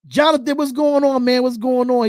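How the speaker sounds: loud, bright hail to a viewer; loud greeting. Real, not acted. Streamer hailing a viewer by name, loud and upbeat. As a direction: call out a big hello. Greeting chat member loudly.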